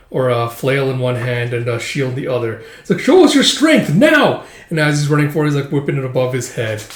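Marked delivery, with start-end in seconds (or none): 3.0-4.4 high, loud taunting roar with a laugh; battle yell. Acted. Warrior charging at Guts, high loud taunt. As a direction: taunt him as you charge.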